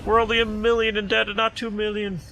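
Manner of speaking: excited. Real, not acted. Joking relief about debt.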